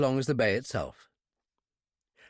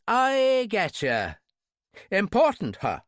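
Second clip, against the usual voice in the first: raised, drawn-out Irish brogue; 7 semitones higher, 4 dB louder, much slower than usual. A narrator's reading. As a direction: cut him short in an Irish accent. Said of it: "as Irish as a sprig of shamrock".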